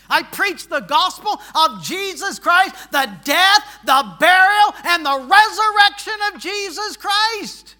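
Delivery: loud, high-pitched gospel proclamation; full-force preaching. Real, not acted. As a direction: thunder out each phrase to the congregation.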